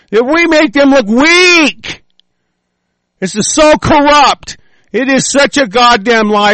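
heated, high-pitched yelling; angry rant. Real, not acted. Speaker rants at listeners about voter fraud, angry yelling.

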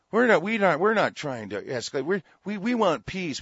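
high, loud sarcastic mimic of a spokesman; sarcastic high parody of a peace-loving spokesman; mock official voice. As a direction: parrot the official line in a high mocking voice. Mocking Israeli officials' claims.

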